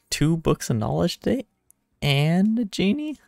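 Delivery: high, loud, rising disbelief; loud, incredulous question; delighted surprise. Real, not acted. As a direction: react amazed at an unexpected reward, pitch climbing. Reacting to quest reward.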